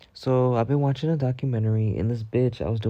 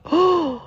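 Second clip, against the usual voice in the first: big, high, sudden gasp of disbelief; shocked gasp; 5 dB louder than usual. Retold or imitated. Storyteller reenacting seeing a cheeseburger swallowed whole, shocked gasp. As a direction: suck in air, dramatically aghast. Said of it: "I was in disbelief".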